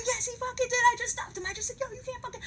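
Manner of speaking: very high-pitched, heated retort; frantic insistence. Retold or imitated. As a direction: argue back in a panicked squeal. Acted yes-no quarrel.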